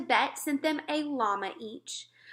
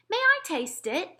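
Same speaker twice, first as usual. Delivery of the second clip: higher, a touch louder, politely asking; a lightly voiced queen asking to taste; the queen asks the giant, higher and polite; 9 semitones higher, 4 dB louder than usual. A narrator's reading. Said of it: "the queen asked".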